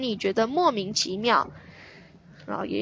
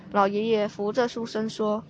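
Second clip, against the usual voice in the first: usual speaking voice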